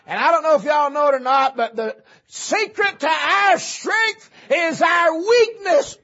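high, loud shouted proclamation; full-force preaching. Real, not acted. Preacher proclaiming strength in weakness from Corinthians, full-force preaching. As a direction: build to a high shout on the key words.

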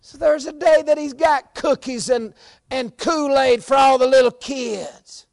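high, loud holler, building to a sustained yell; full-force preaching. Real, not acted. Preacher warning church to line up with Lord, full-force shout.

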